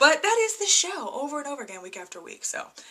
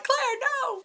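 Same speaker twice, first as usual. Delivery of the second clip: dismayed protest; 13 semitones higher, 4 dB quieter than usual. Retold or imitated. Dismayed at Claire's offer.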